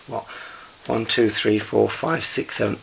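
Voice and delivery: ordinary talk, nothing marked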